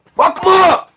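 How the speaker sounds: a sudden, high, furious shout; angry shout. Real, not acted. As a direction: bark it out in rage. Angry call to violence.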